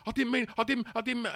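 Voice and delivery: high, panicked stammer; panicked pleading. Retold or imitated. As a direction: plead in terror, pitched high. Cornered lad panicking.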